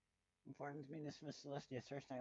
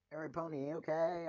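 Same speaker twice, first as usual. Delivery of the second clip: called out, higher and louder; calling out; 4 semitones higher, 7 dB louder than usual. Acted. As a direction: call across to the group. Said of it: "Applejack called out".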